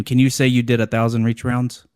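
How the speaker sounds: usual speaking voice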